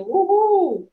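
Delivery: bright high whoop; excited cheer. Pretend. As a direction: cheer like a happy parent. Mock parents cheering gender reveal.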